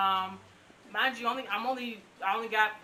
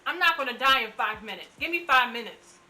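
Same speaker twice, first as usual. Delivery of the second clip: higher and louder, pressing her case fast; pleading urgently; 4 semitones higher, 6 dB louder than usual. Retold or imitated. Patient to rushing doctors, urgent higher pleading. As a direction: bargain hard for five minutes. Begging doctors for five minutes.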